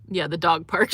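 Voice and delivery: normal talking voice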